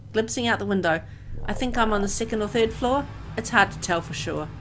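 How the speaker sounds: ordinary talk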